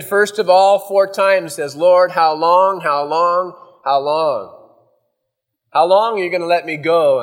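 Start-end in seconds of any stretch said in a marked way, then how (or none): 1.8-7.2 mild, raised plea to God; lightly acted cry of lament. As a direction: plead with a touch of anguish, drawing out "long". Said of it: "as David is crying out".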